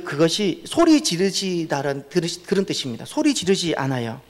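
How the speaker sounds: normal talking voice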